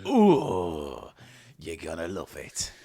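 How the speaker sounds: breathy mock-seductive coo; sultry voice. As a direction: tease the listener.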